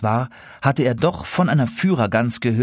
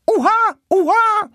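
high, loud teasing honk; mocking goose cry; 5 dB louder than usual. Wild geese taunting the tame geese, loud mocking cry. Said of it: "riefen sie und frotzelten".

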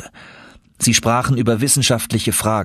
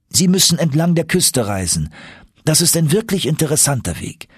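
higher-pitched, obliging scholar; keen, helpful professor pitched up; friendly Icelandic professor, helpful; 8 semitones higher than usual. Acted. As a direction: advise eagerly in a raised voice.

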